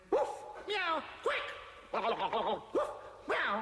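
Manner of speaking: mixed woof and meow, high meow; a grasshopper barking and mewing; playful animal sounds; silly animal noises. Acted.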